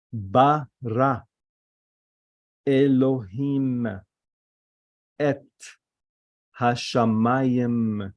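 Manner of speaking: slow, syllable by syllable, with pauses between words; careful vowel demonstration. Shown as a demonstration. Teacher showing students how the Hebrew vowels sound, careful and slow. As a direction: read out each vowel slowly and distinctly. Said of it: "Recognize how I am saying the vowels".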